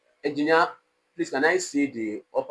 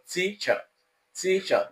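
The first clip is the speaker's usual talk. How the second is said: TEA-cher, first syllable raised in pitch, second lowered; stress on first syllable; 7 semitones higher than usual. Shown as a demonstration. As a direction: lean on the 'tea' of teacher, let '-cher' drop. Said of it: "the pitch of my voice was raised".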